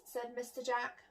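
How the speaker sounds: ordinary delivery, nothing marked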